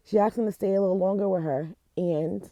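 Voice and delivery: real tears, rushing on to get it said; crying through it. Real, not acted. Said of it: "I don't know why I'm crying".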